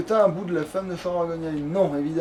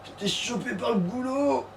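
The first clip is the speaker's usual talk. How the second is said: 1 slow mock NPC reading; 4 semitones higher, 5 dB quieter than usual.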